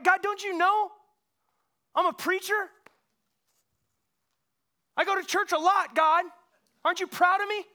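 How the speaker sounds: high, loud, whining complaint; mock-whine like a spoiled child; whiny self-righteous. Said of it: "I'll be bitter toward the God".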